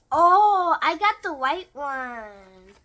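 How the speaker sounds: loud, drawn-out cry of excitement; big loud "oh", then slow delighted announcement; excited reveal. Real, not acted. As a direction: exclaim it loudly, stretching the words out. Reveal after a countdown to opening.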